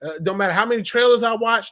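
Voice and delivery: ordinary talk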